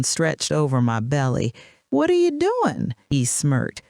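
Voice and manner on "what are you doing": very high, incredulous question; shocked disbelief. A narrator's reading. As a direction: confront him, voice shooting up in disbelief. Wife confronts husband with another woman.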